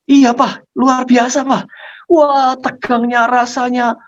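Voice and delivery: put-on high, thrilled child's exclamation; overjoyed. Child answering papa after the successful theft, overjoyed. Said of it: "sambil dia meluapkan kegembiraannya".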